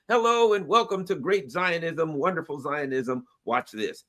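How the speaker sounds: normal talking voice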